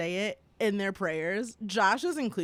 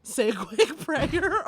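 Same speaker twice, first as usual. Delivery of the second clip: ordinary delivery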